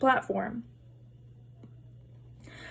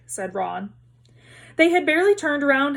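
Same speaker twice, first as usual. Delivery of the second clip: plain reading voice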